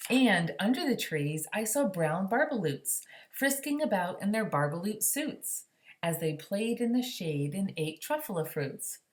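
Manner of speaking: ordinary delivery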